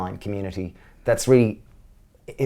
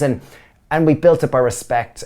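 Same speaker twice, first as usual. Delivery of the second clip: ordinary delivery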